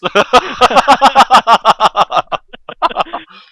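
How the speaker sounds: loud high howling laugh; roaring laughter. Real, not acted. Cracking up at his co-host's joke, loud howl. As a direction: burst out laughing.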